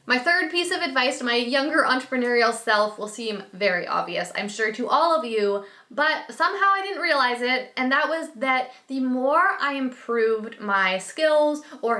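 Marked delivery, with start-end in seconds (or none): none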